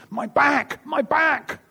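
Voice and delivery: high, loud cry of sudden pain; woman crying out in pain at the rail, a retold scream. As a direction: cry out as if the back just gave way. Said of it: "she screamed".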